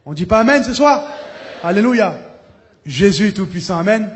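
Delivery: preacher urging his audience to answer amen; rousing call. Real, not acted. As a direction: whip up the crowd for an amen.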